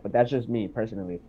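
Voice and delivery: normal talking voice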